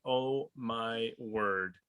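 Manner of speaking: ordinary talk, nothing marked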